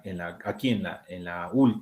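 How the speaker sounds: ordinary talk, nothing marked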